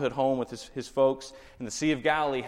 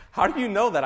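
surprised; 9 semitones higher, 7 dB louder than usual. Retold or imitated. Retold surprised question to stranger.

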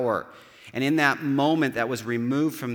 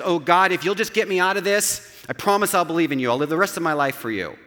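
loud, fast, frantic bargaining with God; desperate foxhole plea; 7 semitones higher, 7 dB louder than usual. Retold or imitated. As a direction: beg God loudly and hurriedly to get you out. Foxhole soldier's desperate bargain prayer.

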